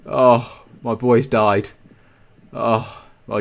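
ordinary delivery

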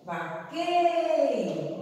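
Vaqueiro with QUEI high, loud and long; stress on middle syllable. Shown as a demonstration. Teacher pointing out the penultimate syllable, QUEI drawn out. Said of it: "ó, quei".

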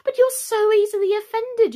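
high, loud protest; pleading. A narrator's reading. Alice excusing herself to the mouse, pleading. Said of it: "pleaded poor Alice".

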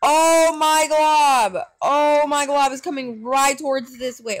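loud, high cry of alarm; alarmed shout. Real, not acted. Player sees the tornado coming, alarmed shout. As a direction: start drawn-out and high, stay loud.

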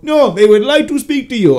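put-on African accent. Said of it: "charming African accent".

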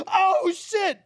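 high panicked yelp, retold; freaking out. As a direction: relive the scare in a sharp high cry. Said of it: "like, freaking out".

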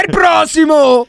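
high, stretched mock bellow; imitating the caller. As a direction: bellow it like calling the next customer. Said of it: "con lo smog che fa".

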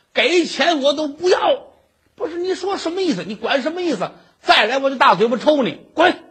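storyteller's acted furious shopkeeper; angry scolding. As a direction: chew him out and send him off. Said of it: "把尤老二给骂走了".

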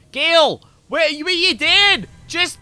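high, loud exasperated yelling; acted-out furious shouting; raging. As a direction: re-enact screaming at a useless teammate, slow and drawn out. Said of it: "I was raging at Gale".

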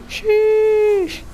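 squeaky falsetto sheesh; a high, loud cartoonish sheesh. Real, not acted.

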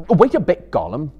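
loud, high call after him; cried out. A narrator's reading. Sam calling Gollum back before he runs off, loud cry. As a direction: call out sharply to stop him. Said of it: "cried Sam".

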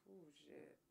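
ordinary talk, nothing marked

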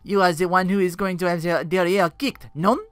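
mocking French accent; light French-accented sneer. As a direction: taunt him like a French jester. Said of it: "French accent".

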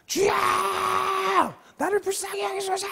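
high, long-drawn cry for mercy; acted desperate cry, stretched and high-pitched; all-out crying out. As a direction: wail it out to someone far off. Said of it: "있는 대로 소리를 질렀어요".

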